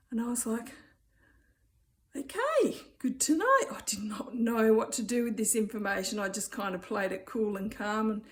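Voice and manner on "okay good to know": high, surprised okay; startled reply. Retold or imitated. To the classmate after her confession, high startled reply. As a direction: fake composure over shock.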